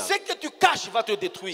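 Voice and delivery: slow sermon warning. Acted. Preacher warns the congregation slowly that hidden things destroy. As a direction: lay each word down like a verdict.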